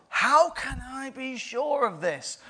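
lightly voiced, puzzled old man asking; doubting Zechariah. Zechariah questions the angel, lightly voiced and doubtful.

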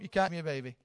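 ordinary talk, nothing marked

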